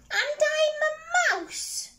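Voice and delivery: high, slow squeaky mouse voice; little mouse. Acted. Mouse answers the troll under the bridge, high squeak. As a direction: squeak each word out slowly.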